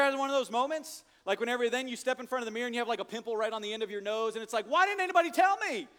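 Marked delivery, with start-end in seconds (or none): 4.7-5.9 mock dismay. Sudden realization in mirror.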